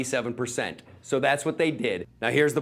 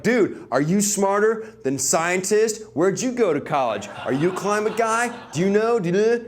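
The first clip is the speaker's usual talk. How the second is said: mock badgering; 5 semitones higher than usual. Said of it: "They're like".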